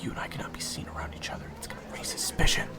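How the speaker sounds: rapid hushed warning; fast urgent whisper. Acted. Waiter Zach warning his friend, fast urgent whisper. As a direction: rush it out under your breath.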